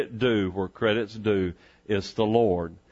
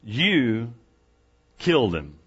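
"You" set apart by a long pause; stress on YOU. Shown as a demonstration. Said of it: "notice "you" twice".

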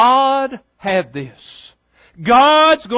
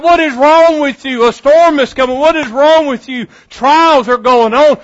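higher, louder, agitated questioning; mock exasperated worrier; 4 semitones higher, 4 dB louder than usual. Imitating a stressed believer scolding the calm friend, loud and higher. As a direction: play the fretful person scolding the calm one. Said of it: "And you're like".

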